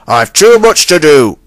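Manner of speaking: flustered Fat Controller. A narrator's reading. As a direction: throw up your hands, too much to do. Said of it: "said the Fat Controller".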